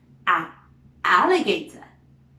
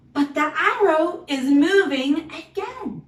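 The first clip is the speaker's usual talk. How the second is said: excited; 7 semitones higher, 5 dB louder than usual. Acted. Teacher excited about moving arrow.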